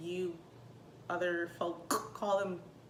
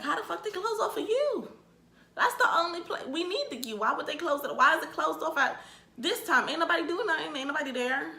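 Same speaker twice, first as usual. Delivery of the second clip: indignant arguing; 9 semitones higher than usual. Retold or imitated. Passenger disputing the closed U-turn, indignant. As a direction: fire indignant questions in a raised voice. Retold indignant argument with driver.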